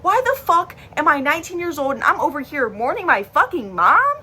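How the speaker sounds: high, indignant venting; worked-up retelling of being furious; retold pissed-off rant. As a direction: act out outraged disbelief, pitch climbing to the end. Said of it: "I was pissed. I'm like".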